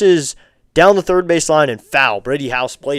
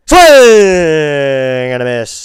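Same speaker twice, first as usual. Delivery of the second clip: drawn-out strikeout call; 10 dB louder, much slower than usual. Acted. Streamer calling the strikeout to his chat, long and loud. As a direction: stretch it long and loud, broadcaster style.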